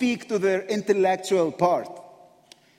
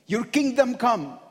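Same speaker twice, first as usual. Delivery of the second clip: full-force preaching; 5 semitones higher than usual. Acted. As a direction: build the sermon to its peak. Preaching at rising intensity.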